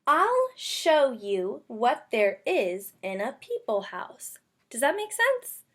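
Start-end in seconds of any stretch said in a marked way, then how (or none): 0.1-4.4 slow, even read-aloud, every word clear; slow careful reading. Shown as a demonstration. Teacher modeling a careful slow read-aloud. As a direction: read it slowly so the kids can follow. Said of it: "Let's read it and see".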